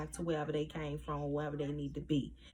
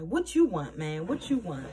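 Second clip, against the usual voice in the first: mock angry demand; 7 dB louder than usual. [name] confronts Andre, mad.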